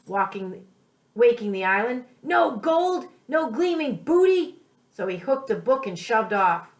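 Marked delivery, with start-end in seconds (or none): 2.2-4.6 pirate's frustrated cry. Acted. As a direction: wail it like a cheated pirate. Pirate finds no treasure.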